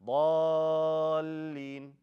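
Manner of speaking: ضالين on a long level note with no push; no nabir. Shown as a demonstration. Teacher shows the error, ضالين without nabir. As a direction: stretch ضالين evenly through the ل.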